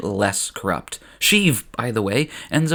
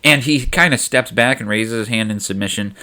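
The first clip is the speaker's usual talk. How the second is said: ordinary delivery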